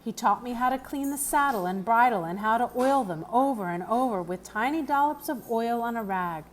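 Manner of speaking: ordinary delivery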